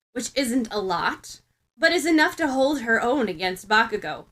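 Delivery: ordinary delivery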